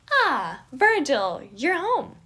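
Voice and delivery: high and loud, thrilled; delighted greeting. Acted. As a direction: greet him with a big grin in the voice. Remus greets Virgil grinning.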